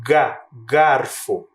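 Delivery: "Ga, garfo" very slow, the "Ga" split off and stressed; slow classroom sounding-out of "Ga, garfo"; syllable demo. Shown as a demonstration. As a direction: sound out "Ga" alone, then "garfo". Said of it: "Perceba o som da letra G junto com o A".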